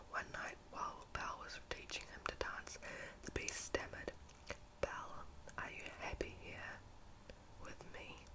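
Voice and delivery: ordinary delivery, nothing marked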